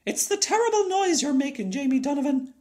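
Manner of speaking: put-on a mad old woman shrieking at a noisy boy; yelling. A narrator's reading. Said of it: "she yelled".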